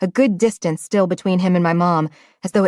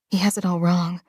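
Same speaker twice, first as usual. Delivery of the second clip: plain reading voice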